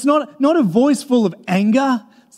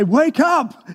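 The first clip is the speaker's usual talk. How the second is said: higher-pitched calling out; acted rousing shout, pitch raised; loud wake-up call; 5 semitones higher than usual. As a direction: snap someone out of a daze. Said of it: "there's gotta be a loud voice".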